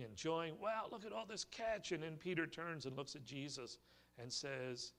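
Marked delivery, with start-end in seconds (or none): 0.6-1.9 celebrating fishermen. Retold or imitated. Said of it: "they were celebrating".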